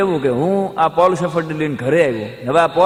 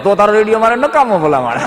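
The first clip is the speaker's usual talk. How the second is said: put-on loud, exasperated old uncle giving up on the radio; fed-up old man; 5 semitones higher, 5 dB louder than usual. Old uncle telling the shopkeeper the radio is useless, exasperated. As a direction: throw up your hands and raise your voice.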